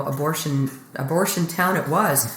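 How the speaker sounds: ordinary talk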